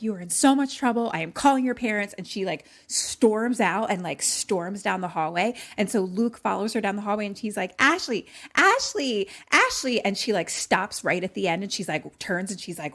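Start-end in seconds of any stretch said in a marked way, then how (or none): 0.0-2.1 loud, fast, higher-pitched acted scolding; raging. Raging girl threatens to call the boys' parents, angry. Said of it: "she's, like, raging". 7.8-10.0 high, loud repeated name, drawn out; calling after her. Retold or imitated. Luke follows her down the hallway, calling out.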